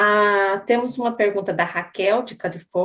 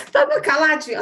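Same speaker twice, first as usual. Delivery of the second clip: laughing; 7 semitones higher, 4 dB louder than usual. Real, not acted. Host laughs at listener.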